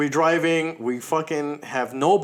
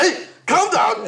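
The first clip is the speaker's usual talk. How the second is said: laughing calm down; 9 semitones higher, 4 dB louder, slower than usual. Retold or imitated. Calming panicking girlfriend, laughing.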